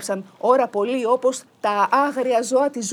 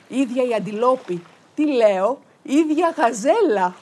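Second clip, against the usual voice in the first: rising, louder as she corrects herself; delighted self-correction; 4 semitones higher, 6 dB louder than usual. A narrator's reading. Narrator delighting in the girl's beauty, rising louder. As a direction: catch yourself and sing out the better word.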